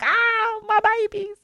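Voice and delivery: high sentimental cry of my babies; soppy high-pitched ah my babies; mock wail. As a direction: swoon over grown kids, voice way up. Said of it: "I'm like".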